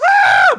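very high shouted shriek; retelling a silent inner scream at the star; shrieking yelp. Retold or imitated. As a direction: scream it out like a starstruck fan. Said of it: "in my head, I was like".

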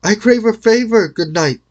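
high, loud plea from afar; young lady crying out to the knight. A narrator's reading. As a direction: call out as you ride up. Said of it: "cried in a loud voice".

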